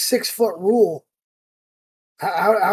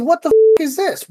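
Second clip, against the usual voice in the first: higher and louder, indignant cry; retold outburst; 9 semitones higher, 3 dB louder than usual. Angry customer confronting the store clerk, indignant outburst. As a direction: burst out at the staff in outrage.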